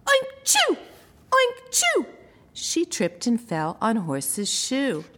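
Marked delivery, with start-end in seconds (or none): 0.1-2.1 very high squealing oink-sneeze; sneezing pig. A narrator's reading. Narrator as the pig, squealing sneeze. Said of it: "Pig awoke".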